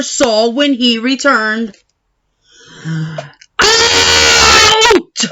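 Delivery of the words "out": much higher, loud shout; furious shout. Acted. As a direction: throw him out with one yell. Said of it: "shouted the baker".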